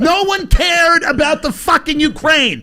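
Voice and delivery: high, slow ranting shout; escalating comedy rant. Acted. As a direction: rant it, fired up.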